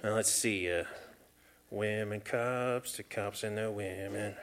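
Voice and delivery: quiet, slow, thinking aloud; muttering to himself. Acted. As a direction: mumble it under your breath.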